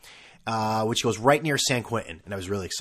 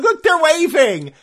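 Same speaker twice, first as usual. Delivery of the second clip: much higher, louder, slow squeal of "look"; high, loud mock excitement; mock excited passenger; 9 dB louder, slower than usual. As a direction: play a gushing ferry tourist. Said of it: "They're like".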